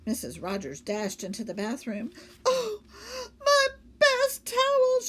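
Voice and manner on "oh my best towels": high, loud, slow lament; dismayed wail. Acted. Lady mourning her best towels, high loud wail. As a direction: wail over the ruined towels.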